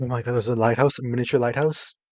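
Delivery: loud thrilled discovery; excited. Real, not acted. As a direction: gasp out the find. Delighted discovery.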